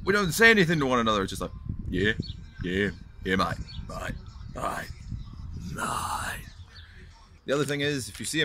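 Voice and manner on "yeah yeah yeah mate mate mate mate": low mumbled acknowledgments fading to near whisper; laconic mate greeting. Acted. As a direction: trail off into barely voiced bloke greetings. Men's wordless greeting, fading.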